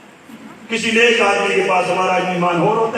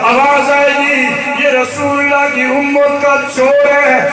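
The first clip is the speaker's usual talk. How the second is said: louder, sung-out sermon storytelling; preaching at full force; 2 semitones higher, 6 dB louder than usual. Acted. Preacher telling the congregation a moving story, chanting.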